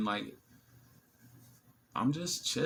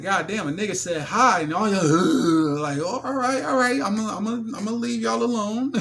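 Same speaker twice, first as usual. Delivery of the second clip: higher, louder joking complaint; mock offended; 10 semitones higher, 9 dB louder than usual. Him to the table of men, mock offended. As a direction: ham up being shunned. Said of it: "I said".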